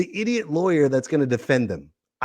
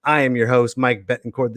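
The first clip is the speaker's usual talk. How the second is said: usual speaking voice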